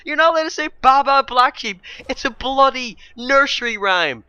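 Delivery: high, loud incredulous shout; real rant boiling over, pitched right up; carried-away outrage. Real, not acted. Vlogger ranting about a banned nursery rhyme, loudly outraged. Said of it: "sorry, I'm getting carried away again".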